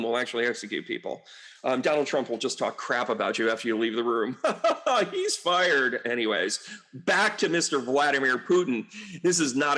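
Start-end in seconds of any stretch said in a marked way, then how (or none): 4.4-5.1 high laugh. Real, not acted.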